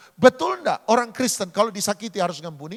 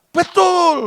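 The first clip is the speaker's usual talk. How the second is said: congregation reply; 10 semitones higher, 10 dB louder than usual. Real, not acted. Congregation answers loudly.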